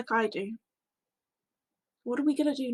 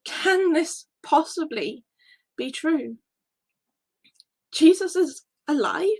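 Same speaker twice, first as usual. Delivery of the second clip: louder, rising question in disbelief; astonished question; 2 semitones higher, 3 dB louder than usual. Acted. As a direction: ask it as if you can't trust it. Hearing the news of resurrection.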